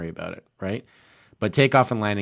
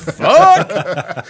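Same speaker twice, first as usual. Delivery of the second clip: laughing curse; 6 semitones higher, 8 dB louder than usual. Retold or imitated. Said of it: "He just goes".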